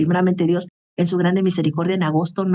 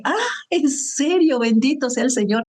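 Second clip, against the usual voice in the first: retold joyful amazement; 5 semitones higher than usual. Speaker recalling her reaction to her friend's news, amazed.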